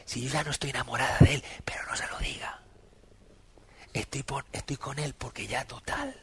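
hushed, dropping to a near whisper on not telling him; secretive confession. Retold or imitated. Old woman confiding she no longer loves her husband, hushed. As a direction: confide it so nobody else hears.

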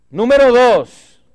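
loud, high, slow announcement; booming point number; announcing point. Real, not acted. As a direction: call out the next point.

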